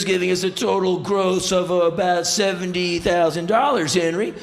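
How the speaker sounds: high, loud, relentless showman patter. Retold or imitated. Rock singer reciting gig figures to [name], high loud showman voice. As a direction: reel off figures like a boastful rock-star announcer.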